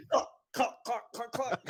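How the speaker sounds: high, rhythmic mock clucks; chicken clucking. Friends riffing on the Virgil chicken, clucking in imitation.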